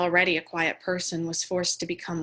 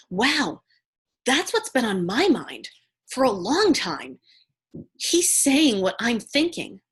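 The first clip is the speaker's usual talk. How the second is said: higher, wowed retelling of a brother; impressed brother; 5 semitones higher, 4 dB louder, slower than usual. Retold or imitated. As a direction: imitate someone marvelling at what they've heard. Brother's admiring words about Trump.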